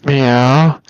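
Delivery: loud rising cat meow. Pretend.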